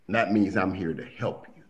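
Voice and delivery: usual speaking voice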